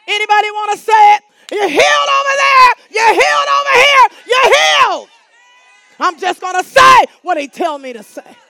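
high, loud fervent preaching; preacher shouting healing over the congregation; shouted declaration. Real, not acted. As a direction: proclaim healing at full voice.

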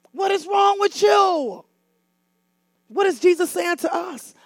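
ordinary delivery

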